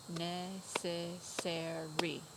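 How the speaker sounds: very slow "Necessary", each syllable apart; syllable count. Shown as a demonstration. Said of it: "How many syllables was that?".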